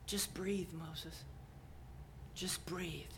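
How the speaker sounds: calm him with a breath; hushed whisper. Acted. God soothing Moses in a whisper.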